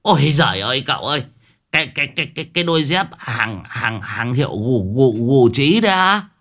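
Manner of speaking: high stammering wail over Gucci sandals; shocked old man wail. Acted. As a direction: gasp in horror, stumble over words. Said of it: "Đồng già vừa nghe vậy thì rú lên". (ông Đồng rú lên kinh ngạc, lắp bắp giọng cao)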